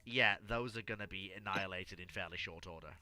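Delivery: ordinary talk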